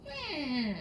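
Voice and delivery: a lightly voiced curious dog; hint of a puzzled pup; dog thinking aloud. A narrator's reading. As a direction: muse to yourself as the dog. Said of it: "he thought".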